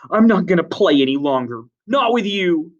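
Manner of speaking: high, loud refusal; hurt defiance. Acted. Jack quits before the boys, loud hurt defiance.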